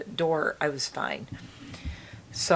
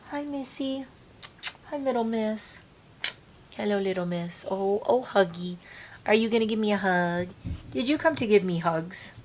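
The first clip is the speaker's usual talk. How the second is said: pet talk; 5 semitones higher than usual. Real, not acted. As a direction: coo sweetly to the little dog. Greeting her dog Eliza.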